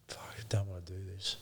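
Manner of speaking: fast whispered dread under the breath; whispered inner dread before knocking. Retold or imitated. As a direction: hiss the thought to yourself, scared of the task. Dreading a death notice.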